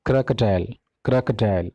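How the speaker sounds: crocodile as "KRAH-kuh-dile"; crocodile, "krak-uh-dial"; correct pronunciation. Shown as a demonstration. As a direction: say crocodile with open first vowel, schwa second. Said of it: "this is crocodile".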